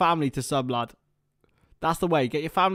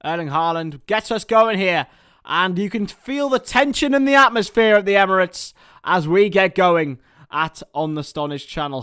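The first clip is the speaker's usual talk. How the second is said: building match commentary, rising into the kick-off; live play-by-play; 3 semitones higher, 5 dB louder than usual. Acted.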